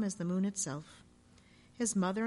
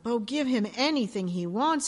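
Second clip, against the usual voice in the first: groaning; 3 semitones higher, 7 dB louder than usual. A narrator's reading. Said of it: "he groaned".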